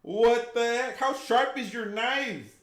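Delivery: high, loud, slowed disbelief; loud shock. Real, not acted. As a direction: blurt it out, shocked. Reacting to knife in foot.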